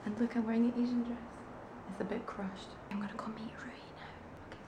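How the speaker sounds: very soft, near-whispered chat to camera; quiet, breath-light morning update; hushed vlog greeting. Real, not acted.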